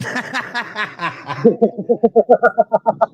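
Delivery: loud, high staccato laughter; real laugh building into loud rapid bursts; hearty laugh. Real, not acted. Cracks up over the confusion.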